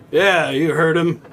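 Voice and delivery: put-on loud high drunk patron. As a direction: bark it like a tipsy regular. Drunk guy at bar agrees.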